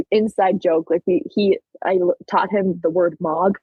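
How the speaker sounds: normal talking voice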